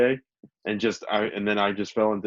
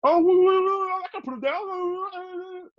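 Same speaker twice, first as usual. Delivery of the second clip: put-on very high, slow, whiny old-man wail; imitating Don Vito; slower than usual. Friend imitating Don Vito at the supermarket, high whiny wail. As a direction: wail it like a flustered old man losing his eggs. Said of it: "Don Vito's like".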